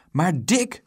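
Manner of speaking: startled motherly outcry; exclaimed. A narrator's reading. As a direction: call out the name in dismay. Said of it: "riep ze uit".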